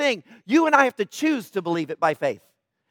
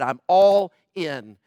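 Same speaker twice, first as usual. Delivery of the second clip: spelled out very slowly; a slow, deliberate declaration; slow emphatic; 5 semitones lower, much slower than usual. Real, not acted. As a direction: give each word its own slow weight. Preacher repeating Jesus' words.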